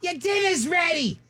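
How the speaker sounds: high, sustained call across the yard; mock calling out. Family member calling dad in to dinner, high mock holler.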